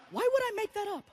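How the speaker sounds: acted high, incredulous question; high-voiced indignant thought; high-pitched disbelief. Indignant inner reply to nurse.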